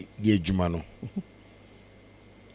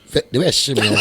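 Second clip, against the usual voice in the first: words melting into a laugh; 5 dB louder than usual. Real, not acted. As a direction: laugh at the memory. Laughs telling shame story.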